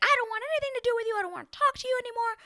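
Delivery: fast, high, loud mock tirade; imitating yelling. Fed-up woman yelling at him, fast high mock rage. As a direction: rattle it off in a high angry shriek. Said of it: "She starts yelling at him".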